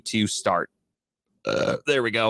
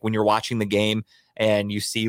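normal talking voice

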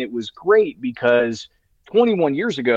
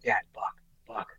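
hushed, urgent whisper; breathless whispered alert; excited whisper; 3 semitones lower, 12 dB quieter than usual. Retold or imitated. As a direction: whisper it so the deer won't hear. Kid spots a buck in blind.

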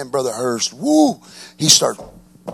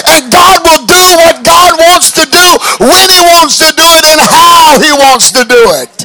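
loud, higher, rhythmic sermon cadence; full-force preaching; 8 semitones higher, 7 dB louder than usual. Acted. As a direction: preach it out to the congregation.

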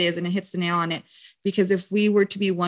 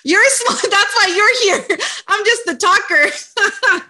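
laughing; 12 semitones higher, 7 dB louder, slower than usual. Real, not acted. Laughs off the compliment.

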